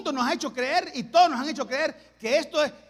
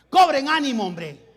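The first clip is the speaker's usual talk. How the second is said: louder on cobren, dropping on hombre; louder rallying call; 8 dB louder than usual. Acted. Paul rallying the prisoners on the ship, louder call. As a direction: push it out, lift their spirits. Preacher voices Paul encouraging men.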